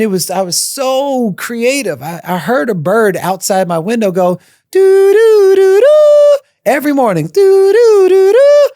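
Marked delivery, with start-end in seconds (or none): 4.7-6.4 high, loud chirping bird call; bird call imitation. Mimicking the bird outside her prison window, high and loud. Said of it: "That was the bird sound". 7.3-8.7 high, loud chirping bird call; bird call imitation. Mimicking the bird outside her prison window, high and loud. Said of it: "That was the bird sound".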